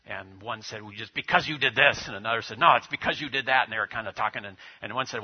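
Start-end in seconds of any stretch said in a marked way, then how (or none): 0.8-2.1 mock argument, louder; voicing a man blaming his partner; retold yelling. Stuck in the mud, one man snaps at the other, mild mock yell. 2.6-3.7 mock argument, louder; tossing the blame right back; retold yelling. The other man fires back in a loud retold quarrel. Jeep stuck, men yelling.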